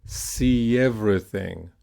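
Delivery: "See everything" joined by a y glide; linked practice model. Shown as a demonstration. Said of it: "So let's practice that".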